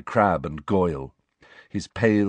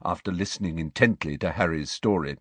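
ordinary delivery

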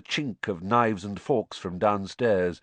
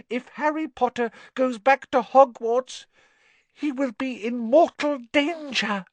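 alarmed house-elf; 4 dB louder than usual. Acted. Dobby warning Harry of mortal danger, squeaky alarm.

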